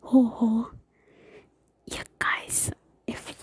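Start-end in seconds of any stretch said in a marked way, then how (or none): 0.0-0.7 "ho ho" said out loud, unwhispered; local expression. Shown as a demonstration. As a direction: voice "ho ho" as a shocked exclamation. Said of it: "Another here you guys will hear is".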